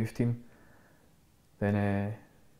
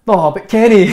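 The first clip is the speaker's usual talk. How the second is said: much higher and louder, half-laughing protest; mock pleading shout; 10 semitones higher, 16 dB louder, slower than usual. Cheeky youth pleading with coach Kenny, loud laughing protest. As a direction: yelp it out like a cheeky teenager.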